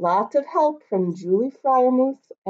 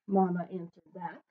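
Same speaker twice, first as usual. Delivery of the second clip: usual speaking voice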